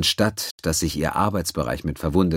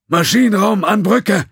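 high, loud call to the bridge; urgent comm call; 9 dB louder than usual. Acted. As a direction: hail the bridge over the din. Engineer calls bridge in smoke.

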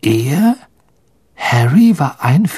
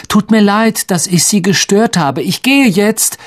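raised, high-pitched near-shout; almost shouting; 9 semitones higher, 4 dB louder than usual. Acted. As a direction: raise your voice for someone hard of hearing. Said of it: "schrie er beinahe". (fast schreiend)

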